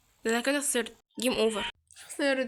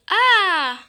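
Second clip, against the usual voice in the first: sharp loud high cry of fright; a real scared shriek; startled scream; 7 semitones higher, 10 dB louder, much slower than usual. Real, not acted. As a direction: yelp as the jump scare hits. Sudden scare in horror game.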